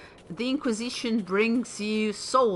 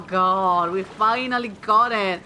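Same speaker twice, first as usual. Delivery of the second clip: ordinary talk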